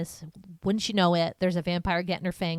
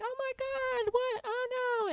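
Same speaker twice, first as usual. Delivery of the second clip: mock alarmed girl; slower than usual. Quoting Marnie in alarm.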